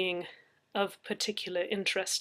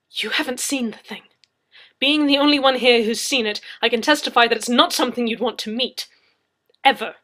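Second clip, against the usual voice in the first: firm, louder warning; stern; 3 semitones higher, 12 dB louder than usual. Acted. Leslie warns Anna sternly. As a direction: sternly warn her off. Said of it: "I said sternly".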